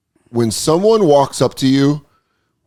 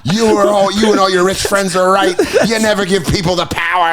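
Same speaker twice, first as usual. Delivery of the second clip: mock revolutionary rant; 6 semitones higher than usual. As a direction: preach it like a hammy rebel. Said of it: "continues villain rant".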